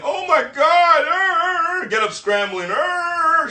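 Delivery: high, loud hammed-up panic with stammered ers; fake panicked waking. Mocking the friend who oversleeps, hammed-up fake panic. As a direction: play the guy faking a scramble out of bed. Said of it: "you try to pretend".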